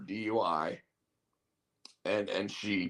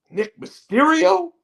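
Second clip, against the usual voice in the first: "Nick Mysterio?" said with a steep, much higher and louder rising question on "Mysterio"; big question mark; 7 dB louder than usual. Retold or imitated. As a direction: make "Nick Mysterio?" a baffled, high, loud question swooping up at the end. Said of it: "With a big question mark".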